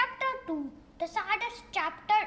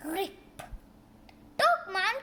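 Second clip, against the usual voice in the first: ordinary delivery, nothing marked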